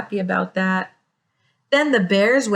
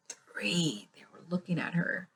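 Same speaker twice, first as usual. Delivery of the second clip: suspenseful whisper; 5 semitones lower, 15 dB quieter than usual. A narrator's reading. Hushed suspense as bears stare.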